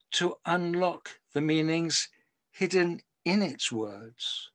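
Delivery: slow, measured poetry recital with long pauses; poem reading. Acted. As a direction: read it like a poet savouring each line.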